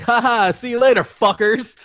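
high, loud taunting villain farewell; hammed-up Joker taunt; gloating Joker. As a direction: jeer down from the getaway helicopter. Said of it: "Joker's all like".

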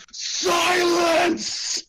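high, loud command to be silent; the cleric silencing the bartender, a loud commanding shout. Acted. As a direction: bark silence with divine force. Pointing at him, commanding.

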